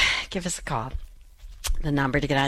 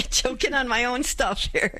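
higher, amused at herself; real laughter breaking into the words; laughing while talking; 6 semitones higher than usual. Real, not acted. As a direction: laugh through your own embarrassment. Laughing at own choking on air.

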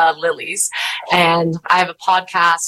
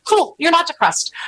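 higher, gently humoring a struggling friend; sweet friend; 10 semitones higher, faster than usual. Retold or imitated. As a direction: soothe her like a kind girlfriend. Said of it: "was so sweet".

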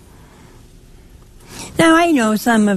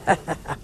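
soft chuckle; 2 semitones lower, 8 dB quieter than usual. Real, not acted. Laughs at a penitent's excuse.

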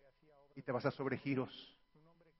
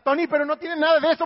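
objeción rápida; 7 semitones higher, 9 dB louder, faster than usual. Acted. Oyente objetando, rápido.

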